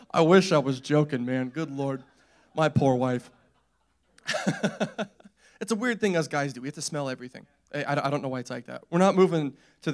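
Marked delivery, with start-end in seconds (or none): none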